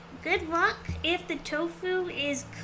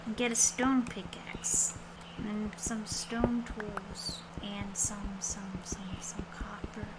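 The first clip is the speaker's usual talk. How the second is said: whispering; 4 semitones lower, 6 dB quieter than usual. Real, not acted. Muttering to self while mining.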